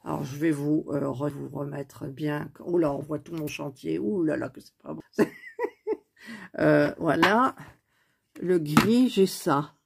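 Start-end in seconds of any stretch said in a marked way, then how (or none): 5.1-5.9 a quick high-pitched giggle. Real, not acted. Crafter laughing at her messy workspace, high giggle. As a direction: burst into a short squeaky laugh.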